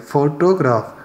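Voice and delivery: Photograph with strong FO, ordinary to-graf; photograph, first syllable stressed and the rest relaxed. Shown as a demonstration. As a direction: lean on the FO of photograph. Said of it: "टोग्राफ को बिल्कुल normal पड़ा है".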